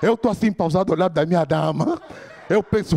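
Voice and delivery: ordinary talk, nothing marked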